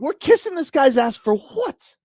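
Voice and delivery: high, drawn-out exasperated question; exasperated disbelief; indignant protest. Real, not acted. Indignant argument over the list.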